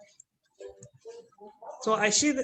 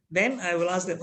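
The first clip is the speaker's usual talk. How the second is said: usual speaking voice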